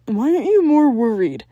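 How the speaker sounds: loud, high booming demand; hammy dad voice barking a question; fierce questioning. A narrator's reading. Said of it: "Jack fiercely questioned".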